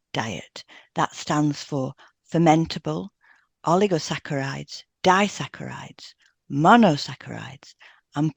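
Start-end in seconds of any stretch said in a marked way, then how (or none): none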